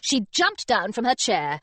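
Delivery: usual speaking voice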